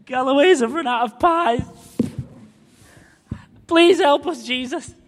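high, loud, whiny mock prayer; mock panicked prayer. Preacher mocking a worried believer's prayer, high and whiny. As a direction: ham up a desperate plea to God.

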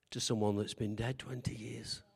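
breathy whisper of an unsettling thought. Acted. Preacher to congregation, hushed whisper. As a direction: lean in and whisper it. Dramatic aside in sermon.

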